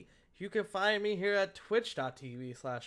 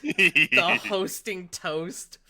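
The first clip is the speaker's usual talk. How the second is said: drawn-out mock announcement; 8 dB louder, much slower than usual. Real, not acted. Host mock scolding chat.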